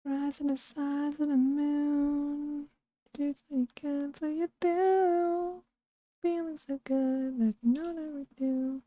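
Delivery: mock Bane muffled behind a mask; muffled Bane impression. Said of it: "he's like".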